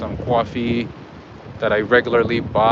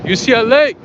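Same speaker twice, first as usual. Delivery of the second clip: proud shout; 11 semitones higher, 6 dB louder than usual. Retold or imitated. Answering a stranger, shouting his university proudly. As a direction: shout it out high and loud, proudly.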